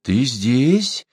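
rising, amazed question; storyteller voicing startled surprise; astonished. A narrator's reading. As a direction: ask it in sudden amazement. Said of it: "изумлённо воскликнул Сибун".

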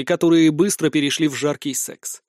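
plain reading voice

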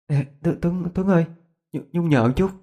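put-on high, hesitant girl's voice; lightly voiced girl, stammering a plea; timid girl. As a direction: wake a friend with a timid, high-pitched plea. Timid girl wakes friend at night.

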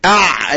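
أع held on a voiced throat squeeze; ayn demo. Shown as a demonstration. Teacher shows a middle-throat letter, ayn demo. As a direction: tighten mid-throat to end أع. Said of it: "العين والحاء".

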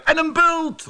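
very high-pitched interjection; dromedary calling out. A narrator's reading. Dromedary demands a hump for the human, calling out high. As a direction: cry out high, topping the others. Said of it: "riep de dromedaris".

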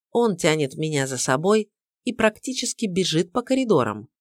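ordinary delivery, nothing marked

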